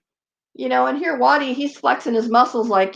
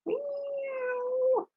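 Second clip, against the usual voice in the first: high, soft drawn-out cat meow; imitated meow; 13 dB quieter than usual. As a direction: mimic a cat quietly, stretching it out.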